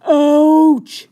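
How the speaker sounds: very loud sudden ouch; yelp of pain. A narrator's reading. Maurice yelps when Rosie bites. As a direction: yelp as if bitten. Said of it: "says Maurice".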